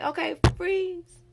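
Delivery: high, loud, drawn-out freeze; playground freeze-tag call. Retold or imitated. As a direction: call it out like a kid playing tag. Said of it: "when we was little and we played freeze tag".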